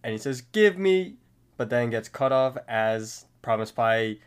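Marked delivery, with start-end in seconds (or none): 0.4-1.2 high, loud demanding yell; mock scream at someone; imitated scream. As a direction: yell it in character. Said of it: "he screams over to the pie".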